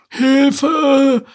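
very high, loud drawn-out shriek; high screeched cry. Acted. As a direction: turtle screeching for help. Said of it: "kreischte Larissa".

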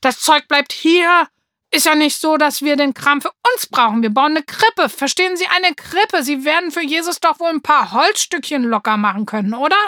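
put-on a boy yelling down the store over a crib; furious yelling. A narrator's reading. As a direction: roar so the whole shop hears. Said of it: "brüllte dabei den Laden zusammen".

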